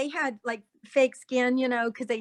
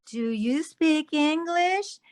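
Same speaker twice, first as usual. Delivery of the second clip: very slow, higher question, stretched out; imitated lady; 4 semitones higher, much slower than usual. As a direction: imitate someone carefully addressing a foreigner, slowly and higher. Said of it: "this lady goes".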